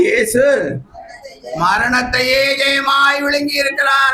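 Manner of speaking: preacher declaring victory; impassioned preaching. Acted. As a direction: proclaim it in a high, ringing chant-like line. Preaches at raised pitch.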